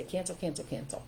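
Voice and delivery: soft but firm, shutting the thought down; quietly cancel the thought, decisive; low, resolute inner command; firm self-correction. Shown as a demonstration. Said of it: "I would stop for a minute and I would say".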